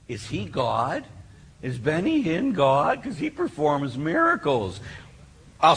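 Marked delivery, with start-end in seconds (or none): none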